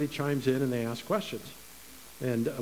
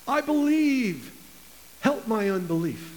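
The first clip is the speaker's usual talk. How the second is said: crying out; 6 semitones higher than usual. Retold or imitated. Father pleading with Jesus for his son, crying out. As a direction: cry out desperately for help. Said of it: "the father of the child cries out".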